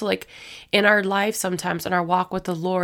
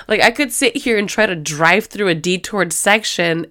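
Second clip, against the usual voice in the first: ordinary talk, nothing marked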